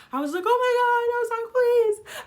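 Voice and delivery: retold panic on the phone; frantic. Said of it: "how frantic I was".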